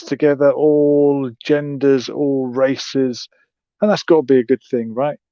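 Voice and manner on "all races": ordinary delivery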